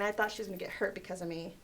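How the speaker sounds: ordinary delivery, nothing marked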